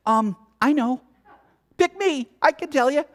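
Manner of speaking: high, eager hand-raising voice; sarcastic eager reply. Retold or imitated. Answering the complainer, mock eager and high. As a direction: play the overeager know-it-all. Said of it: "I wanna say".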